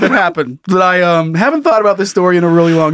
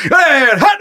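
quarterback's snap call; 7 semitones higher than usual. Retold or imitated.

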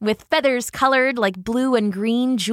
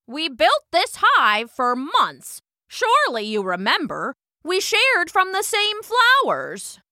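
pleading queen bee; 9 semitones higher than usual. A narrator's reading. Queen bee appealing to hummingbird's memory, insistent. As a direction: stretch key words, rising in insistence.

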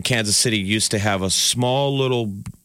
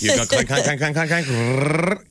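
high, sing-song clank, clank; clanking noises; 6 semitones higher than usual. Retold or imitated. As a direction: mimic a coaster chain lift. Imitating the coaster chain lift.

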